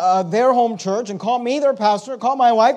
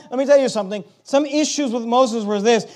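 ordinary talk

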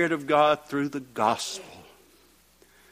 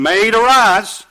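loud, raised command; called out; 9 semitones higher, 12 dB louder, slower than usual. A narrator's reading. Jesus calling a dead girl back to life, called out. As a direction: call it out, rising high on "arise". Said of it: "called out, saying".

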